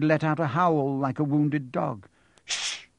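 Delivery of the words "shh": sharp hushing hiss; hissing shush. A narrator's reading. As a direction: hiss for silence. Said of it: "hissed Professor McGonagall".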